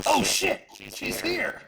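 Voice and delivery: high-pitched alarmed cry; sudden high yelp of warning; in-character shout. Acted. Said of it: "she is going to shout".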